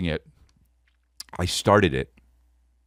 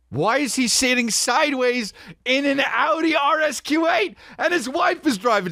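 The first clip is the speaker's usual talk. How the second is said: shrill falsetto gossip; mock onlooker. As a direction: squeal it like a gossiping passerby. Imagined onlooker asking, mocking.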